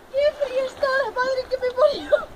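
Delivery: high, strained cry from the freezing cold; in ice cold water. Real, not acted. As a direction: gasp it out, still in icy water. Just jumped into icy river.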